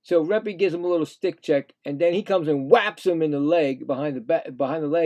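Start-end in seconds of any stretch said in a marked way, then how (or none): none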